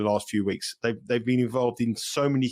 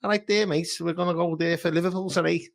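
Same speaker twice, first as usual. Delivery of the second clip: higher, hammed-up Liverpool accent; a Londoner doing a bad Liverpool accent; mock Scouse Liverpool accent; 6 semitones higher than usual. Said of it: "Or shall I go a bit Liverpool?".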